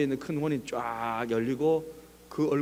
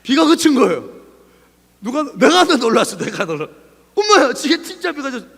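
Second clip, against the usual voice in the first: high, loud burst of amazement; astonished; 9 semitones higher, 12 dB louder than usual. Acted. Believer seeing the rain really stopped, astonished outburst. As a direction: cry out in disbelief, pitched high. Amazed that prayer was answered.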